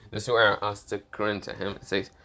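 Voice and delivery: plain reading voice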